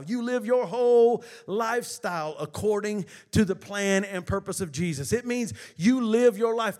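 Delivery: loud, rousing sermon delivery; preaching. Acted. Pastor rousing the church to give God glory, loud preaching. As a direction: preach it out to the congregation.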